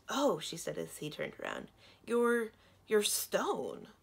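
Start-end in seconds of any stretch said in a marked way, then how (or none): 0.1-0.4 higher, louder gasp of realisation; surprised. A narrator's reading. Princess sees the prince turn around, surprised. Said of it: "her eyes widened". 2.0-3.9 halting, slow, high-pitched amazement; stumble, then stretch the last word in disbelief; astonished. A narrator's reading. Princess realises the prince is made of stone, amazed.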